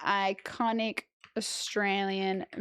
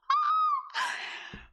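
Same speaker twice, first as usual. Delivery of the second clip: high-pitched wordless cry of exasperation; high exasperated wail. Real, not acted. Streamer reacting to the movie accent, high pained wail.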